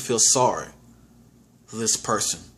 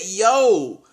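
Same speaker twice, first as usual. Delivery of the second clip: higher "yo" of disbelief; retold startled exclamation; 6 semitones higher, 3 dB louder than usual. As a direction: re-enact being jolted awake. Repeats his shocked exclamation.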